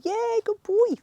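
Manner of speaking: high, loud praise for the dog; excited dog praise. Real, not acted.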